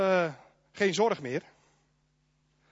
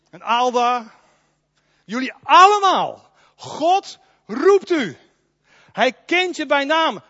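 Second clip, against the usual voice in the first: loud, raised preaching voice; preaching at full force; 4 semitones higher, 8 dB louder than usual. Real, not acted. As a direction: proclaim it to the whole church. Preaches God calls by name. (luid en vurig preken)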